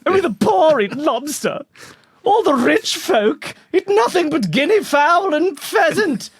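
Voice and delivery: put-on high-pitched comic eighteenth-century New England accent; mock old New England accent. Settler scorning lobster as food for the poor, in mock old New England accent. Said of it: "They hadn't lost the accent yet".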